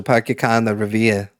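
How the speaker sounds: dropped R's in a Massachusetts accent; offhand Boston-area accent. Shown as a demonstration. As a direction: say it like a Massachusetts local. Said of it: "my Massachusetts accent might come out".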